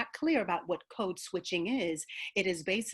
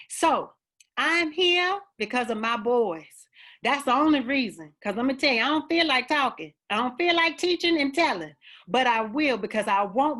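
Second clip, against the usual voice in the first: louder and higher, fervent and fed up; raised, heartfelt exasperation; impassioned outburst; 4 semitones higher, 7 dB louder than usual. Real, not acted. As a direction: let it burst out, she's had enough of explaining. Impassioned plea about her sons.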